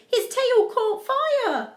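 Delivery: high, slow, loud climax; dramatic exclamation. A narrator's reading. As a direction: cry out the shock, drawn out. Climax of the story, wolf burns.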